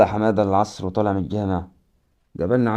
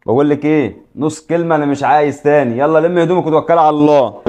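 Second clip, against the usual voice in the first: loud, higher angry yelling; yelling at his wife; 5 semitones higher, 4 dB louder than usual. Acted. Husband yelling at his wife to pack and leave, angry shout. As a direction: throw her out at the top of his voice. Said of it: "انت عمال تزعق".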